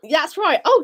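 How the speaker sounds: speaking with the hiccups. Real, not acted. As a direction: hiccup between words. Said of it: "I got the hiccups".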